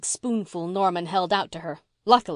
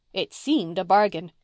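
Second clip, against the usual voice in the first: usual speaking voice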